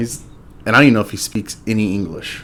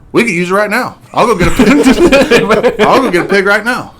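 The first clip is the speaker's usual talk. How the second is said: high and giggling, words breaking into laughter; laughing through the quote; 9 semitones higher, 5 dB louder than usual. Retold or imitated. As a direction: quote the neighbor while cracking up. Neighbor offering to roast pig.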